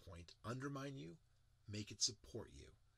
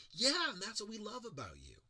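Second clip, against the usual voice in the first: 1 friends reassuring; 9 semitones higher, 5 dB louder than usual. Retold or imitated. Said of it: "your friends go".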